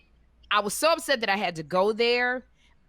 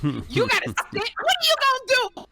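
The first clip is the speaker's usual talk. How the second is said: high, incredulous question at the end; exasperated scolding; 3 dB louder than usual. Retold or imitated. Scolding friend Kim to sit down, rising to a high incredulous question. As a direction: demand it like she can't be serious.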